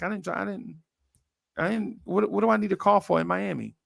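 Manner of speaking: lightly voiced puzzled friend. Friend's reply, retold.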